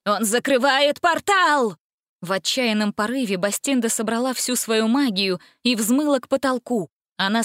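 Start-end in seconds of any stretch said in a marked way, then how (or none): 0.1-1.8 high, drawn-out outcry; alarmed cry. A narrator's reading. Witch warns her sisters of the portal, crying out. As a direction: cry out the warning, pitch raised. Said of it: "закричала Гингема".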